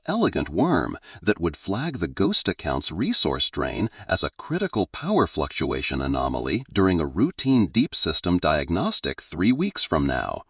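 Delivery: ordinary delivery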